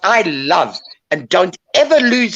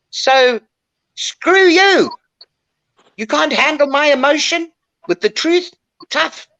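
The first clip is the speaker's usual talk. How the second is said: higher, defiant telling-off; mock outburst, pitched high; retold defiant outburst; 8 semitones higher than usual. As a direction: throw it back at them, defiant. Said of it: "then one day I decided".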